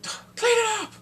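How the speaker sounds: high, loud barked command; yelled order. Retold or imitated. Husband ordering his wife, loud high yell.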